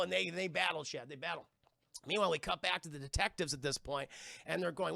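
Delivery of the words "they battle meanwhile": ordinary delivery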